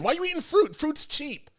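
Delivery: high, scolding parent voice; mock scolding mother, pitched high. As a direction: nag like a mom who paid for the meal. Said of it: "Let me not talk like that to my mother".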